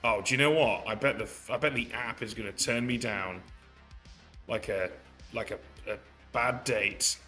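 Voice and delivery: usual speaking voice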